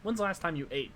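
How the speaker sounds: lightly voiced old crone, insistent; wizened old crone. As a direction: press the traveller like a pushy old witch. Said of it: "wizened old crone voice".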